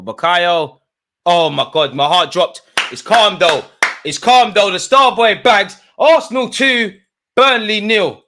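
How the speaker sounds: high, loud joyful shout; goal celebration. Real, not acted. Fan celebrating Saka's penalty to chat, loud high joy.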